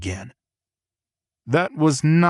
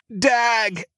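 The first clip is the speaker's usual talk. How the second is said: scream; 13 semitones higher than usual. A narrator's reading. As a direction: cry it out high at the peak. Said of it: "as I screamed".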